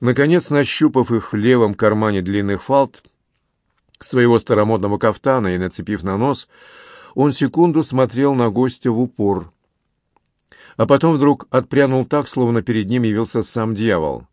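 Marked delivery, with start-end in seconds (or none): none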